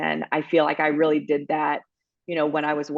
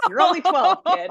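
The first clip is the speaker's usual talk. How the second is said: laughing hard through the words, much higher and louder; 12 semitones higher, 5 dB louder than usual. Retold or imitated. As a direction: blurt out the teasing reply to a son while cracking up. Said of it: "And I was like".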